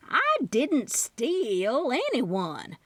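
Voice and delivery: slow, drawn-out denial; a slow, drawn-out denial to convince her niece. A narrator's reading. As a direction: stretch every word to convince her. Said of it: "Aunt Maxie drew out her word".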